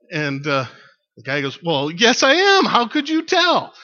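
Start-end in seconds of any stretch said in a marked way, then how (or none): 1.6-3.7 hammed-up astonished yell; surprised shout. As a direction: voice leaping very high and loud.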